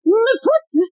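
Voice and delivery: high pained cry; yelping about a stepped-on foot. Acted. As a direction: shriek as your foot is crushed. Foot trodden on, cries out.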